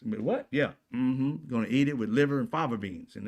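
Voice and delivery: ordinary talk, nothing marked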